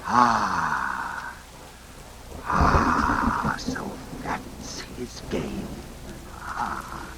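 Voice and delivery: low breathy murmur to himself, slowed down; dawning realization. Acted. Master to himself, realizing the drink was poisoned, hushed and slow. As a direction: let the truth sink in under your breath.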